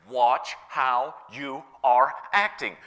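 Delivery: slow, each word set apart; deliberate warning. Retold or imitated. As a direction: space out every word for weight. Driving the warning home.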